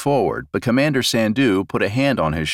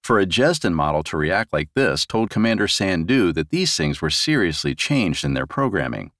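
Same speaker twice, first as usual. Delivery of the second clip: plain reading voice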